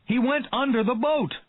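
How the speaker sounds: high, loud alarm; urgent shout. A narrator's reading. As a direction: keep warning Quint. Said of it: "cried Hooper".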